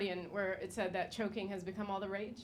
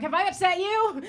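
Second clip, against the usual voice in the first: mock surprise; 10 semitones higher, 9 dB louder than usual. Mock reaction to chokehold.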